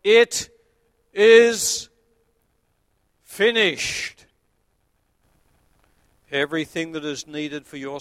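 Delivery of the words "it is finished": high, loud, triumphant cry; a loud cry of completion; loud final cry. Retold or imitated. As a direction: proclaim it with the last breath. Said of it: "he cried out in a loud voice".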